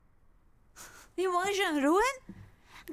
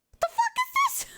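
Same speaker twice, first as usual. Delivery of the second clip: very high, loud squeal; real disbelieving shriek; screaming disbelief; 6 dB louder, faster than usual. Real, not acted. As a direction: shriek it at the top of the voice. Peak disbelief.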